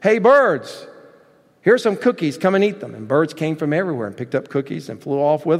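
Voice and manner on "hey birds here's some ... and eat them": high, raised storybook call; shouting out. A narrator's reading. Frog to the birds outside, shouting out. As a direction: call out as if across a yard, mildly loud. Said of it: "he shouted out into a loud voice".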